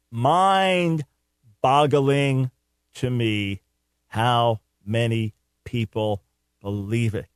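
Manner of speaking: very slow, dropping low at the end; slow disbelief. Real, not acted. Radio host marvels at how many people believe it, slow, weighty disbelief. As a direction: spell out your disbelief word by word, drawn out.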